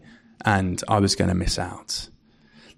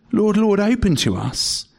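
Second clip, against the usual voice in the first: high, desperate plea to be let in; a mock desperate plea, high and urgent; pleading cry; 10 semitones higher than usual. Foolish virgins begging at the shut door, high pleading cry. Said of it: "the desperate cry".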